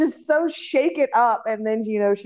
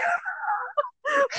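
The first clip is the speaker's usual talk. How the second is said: tearful laugh; 6 dB quieter than usual. Retold or imitated. Mimicking the shaky voice.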